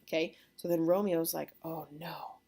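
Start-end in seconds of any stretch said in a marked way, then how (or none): 1.6-2.4 hushed dismay. Retold or imitated. Romeo finding out she is a Capulet, hushed mock dismay. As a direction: voice drops away in mock dread.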